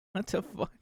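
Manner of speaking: high-pitched disbelieving outburst; incredulous. Real, not acted. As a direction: sound baffled and outraged. Reacts to raffle rewards.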